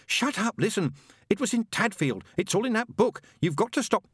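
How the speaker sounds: high-pitched, insistent pleading; frantic urgency. Acted. Angel tries to warn demon about Tadfield, frantically. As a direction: talk over the machine in a panic.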